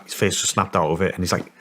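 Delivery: ordinary delivery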